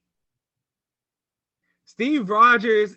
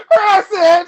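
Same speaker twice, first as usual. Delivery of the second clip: laughing; 12 semitones higher, 5 dB louder than usual. Real, not acted. Laughing hard.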